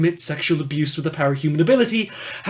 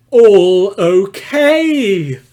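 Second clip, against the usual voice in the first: sarcastic; 5 semitones higher, 8 dB louder, much slower than usual. Acted. Sarcastic verdict on a murderer.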